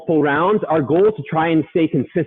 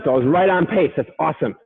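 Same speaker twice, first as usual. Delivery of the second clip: ordinary delivery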